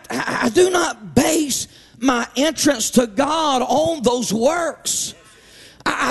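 rising, sung-out preacher cadence; preaching. Acted. Preacher to Wednesday night congregation, fervent preaching voice. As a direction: preach it with full fervor to the church.

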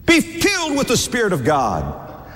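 "Be filled" pitched high, falling to "God"; high-pitched pulpit emphasis on "filled"; emphatic exhortation. Real, not acted. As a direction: repeat it as a high, urgent preacher's call.

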